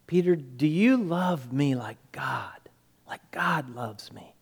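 soft, low, near whisper; hushed plea. Retold or imitated. Jesus asking Peter about his love, hushed plea. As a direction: ask it intimately, almost whispering.

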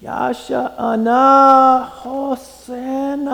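"Yasha ana" stretched slowly and melting into "Hosanna"; high, long vowels sliding from Yasha ana to Hosanna; morphing pronunciation. Shown as a demonstration. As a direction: draw out "Yasha ana Hosanna" as one slow phrase. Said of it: "how you say that".

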